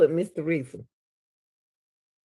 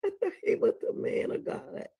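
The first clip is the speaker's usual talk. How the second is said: laughing cry; 11 semitones higher, 5 dB quieter than usual. Real, not acted. Laughing-crying disbelief.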